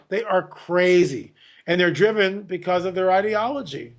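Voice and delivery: normal talking voice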